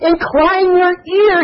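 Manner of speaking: INCLINE stretched slow and high, ear falling; drawn-out verse. Shown as a demonstration. Preacher reads the verse to his congregation, slow and high. Reading verse against the joke.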